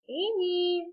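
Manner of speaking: high, drawn-out call. A narrator's reading. Dad looking for Amy, calling out. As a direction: holler the name across the barn. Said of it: "Amy's dad called out".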